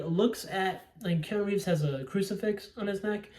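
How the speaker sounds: ordinary delivery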